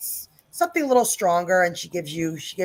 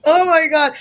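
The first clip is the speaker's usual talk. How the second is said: laughing; 4 semitones higher, 5 dB louder than usual. Real, not acted. GM laughing to chat.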